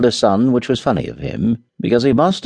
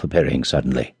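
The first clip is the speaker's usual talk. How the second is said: plain reading voice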